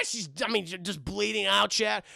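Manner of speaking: usual speaking voice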